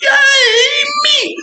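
high squealing yay; excited cheer. Real, not acted. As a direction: shriek with delight. Host cheers herself.

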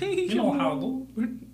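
high, slow cartoon character voice; Connie impression. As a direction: coo it like Connie. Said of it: "like Connie".

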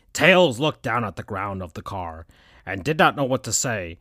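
ordinary delivery

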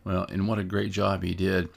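ordinary delivery

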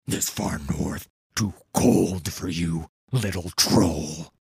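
low, slow, loud troll growl; rumbling gravelly voice. A narrator's reading. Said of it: "said Yedu".

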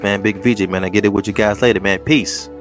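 ordinary talk, nothing marked

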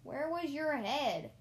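loud, high, drawn-out jeer; exaggerated slow character voice; scolding cousin. A narrator's reading. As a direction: stretch the question out mockingly. Cousin joins the family scolding.

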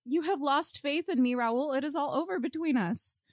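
high-pitched theatrical declamation; grand, hammed-up farewell, pitched high; melodramatic breakup. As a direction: declaim it like a high-voiced tragic heroine. Acting out the breakup line.

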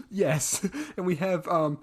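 ordinary delivery

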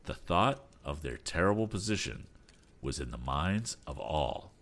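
plain reading voice

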